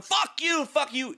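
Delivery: high-pitched mock yell; imitated angry yelling. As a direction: mimic a man yelling abuse. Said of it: "yelling at me".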